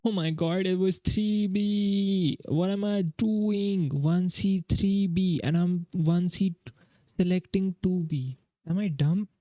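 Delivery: high, slow groan at her own mistake; exasperated disbelief. Real, not acted. Realises she picked wrong answer.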